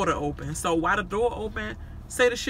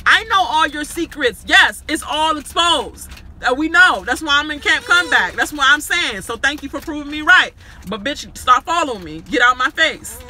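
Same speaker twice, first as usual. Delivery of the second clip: louder, higher furious comeback; a retold blow-up, much louder and higher; heated retort; 6 semitones higher, 6 dB louder than usual. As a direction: fire it back at her, loud and raised. Reliving her own heated retort.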